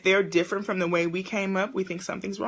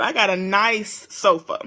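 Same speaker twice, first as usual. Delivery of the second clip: normal talking voice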